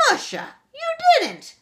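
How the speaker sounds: very high, loud cry of dismay. A narrator's reading. Auntie Moira crying out at the scrubbed footprints. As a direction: wail it in horror. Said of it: "cried Auntie Moira".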